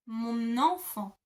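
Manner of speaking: "Mon enfant" slow, the N glued onto "enfant"; liaison shown. Shown as a demonstration. Teacher repeating the linked form for learners. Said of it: "it makes a N kind of sound".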